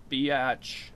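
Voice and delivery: ordinary talk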